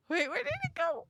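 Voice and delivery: high, fearful question; anxious. Real, not acted. Player waiting for the monster to return, afraid. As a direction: ask nervously, bracing for a jump scare. Monster vanishes, jump scare fear.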